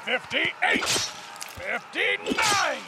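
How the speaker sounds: high, shouted tally; triumphant kill count. Acted. Fighter tallies victims in battle, shouting triumphantly. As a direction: yell each number exultantly. Attacker counts his kills.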